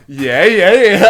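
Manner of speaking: loud, high mock-enthusiastic yeah yeah; sarcastic yeah. Mocking the direction-giver, sarcastic loud agreement. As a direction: egg him on sarcastically. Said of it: "I was probably being really sarcastic".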